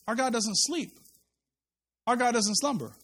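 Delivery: normal talking voice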